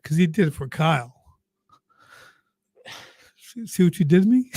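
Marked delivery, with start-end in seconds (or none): 3.4-4.5 higher, teary half-laugh through emotion; welling up. Real, not acted. Father to the host after telling of Kyle, teary. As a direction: caught by tears, laughing it off. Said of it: "you're making me well up here".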